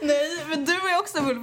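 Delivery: usual speaking voice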